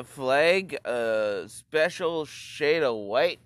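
usual speaking voice

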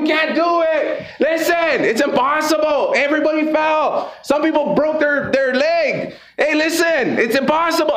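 high, insistent acted yelling; yelling discouragement. Crowd telling the climbing frog to give up, high mock yelling. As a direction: holler each warning up the tree, high and drawn out. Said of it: "they're yelling at him".

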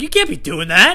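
high, loud reprimand; hammed-up scolding shout; screamed scolding. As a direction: yell at a shoplifter in outrage. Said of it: "I'm screaming that like that".

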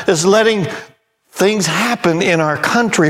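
high, ringing sermon voice; fiery preaching. Real, not acted. Preacher to congregation, fiery pulpit preaching. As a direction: preach it hard against sin.

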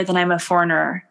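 ordinary delivery

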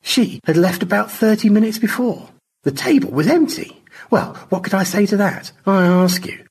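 high, incredulous complaint; indignant storyteller. Acted. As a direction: appeal in high-pitched disbelief.

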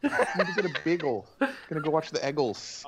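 self-mocking Pennsylvania accent. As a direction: send up his own Pennsylvania accent. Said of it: "the heavy Pennsylvania accent".

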